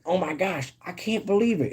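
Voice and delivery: high, amazed cartoon voice; Squidward. Streamer retelling the edited cartoon meme, high mock amazement. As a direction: swoop up high in mock disbelief. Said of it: "he's like".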